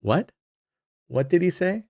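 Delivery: mock-confused questions with sharply rising ends; puzzled listeners. Viewers reacting to a murmured tip, mock puzzled. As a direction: imitate confused listeners, pitch lifting at the end. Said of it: "and people are like".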